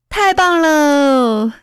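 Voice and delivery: put-on long drawn-out, high cheer from kids; gleeful yell. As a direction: stretch it out slowly like children cheering. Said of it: "林家的孩子们扯开嗓子喊".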